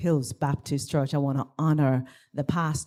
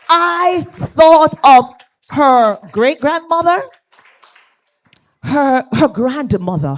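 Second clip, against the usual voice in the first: emotional rise; 7 semitones higher, 9 dB louder than usual. Real, not acted. Emotional memory of elders.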